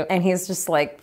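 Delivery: normal talking voice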